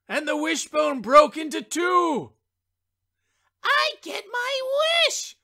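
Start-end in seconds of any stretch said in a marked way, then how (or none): 3.6-5.4 put-on much higher, gleeful childlike cry; triumphant child. A narrator's reading. Child winning the wishbone pull, high and gleeful. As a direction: crow with delight at winning. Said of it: "cried the child".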